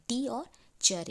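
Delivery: "टी और चर" slow, each sound set apart; syllables named separately. Shown as a demonstration. Teacher counting two sounds, "टी" and "चर" said separately. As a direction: isolate "टी" and "चर" as two beats. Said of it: "तो दो sound आ रहा है".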